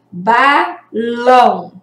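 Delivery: slow "Balão" with every syllable drawn out; slow, clear model word. Shown as a demonstration. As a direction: model "Balão" slowly for the class to repeat. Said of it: "falem alto".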